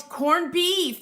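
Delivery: high, loud eureka on "corned beef"; excited recall. Real, not acted. Remembering the dish's name for viewers, excited. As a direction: blurt it out as it comes to mind. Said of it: "that's it".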